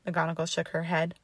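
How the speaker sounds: plain reading voice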